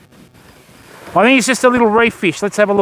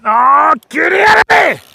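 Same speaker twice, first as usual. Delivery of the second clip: loud, much higher cry at the snagged fish; frustrated shout; 5 semitones higher, 4 dB louder than usual. Real, not acted. Angler shouts at a fish stuck in the reef, loud frustrated cry. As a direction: yell at the fish to get out of the reef.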